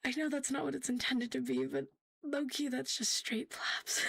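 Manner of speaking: normal talking voice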